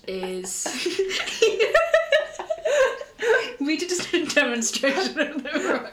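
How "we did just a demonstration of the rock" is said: talking through laughter; laughing. Real, not acted. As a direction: giggle through the line. Laughing about rock candy.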